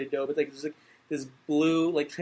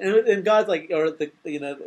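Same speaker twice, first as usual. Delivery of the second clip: ordinary talk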